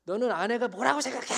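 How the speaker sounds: high, loud angry scolding; angry mother. Retold or imitated. Furious mother scolding her pastor son about his wife, angry yell. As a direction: imitate a furious mother's fast, rising yell. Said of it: "막 화를 내시면서".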